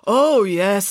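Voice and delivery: sudden "oh" of recall; suddenly remembering. A narrator's reading. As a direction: let the memory hit you all at once. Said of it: "suddenly remembering".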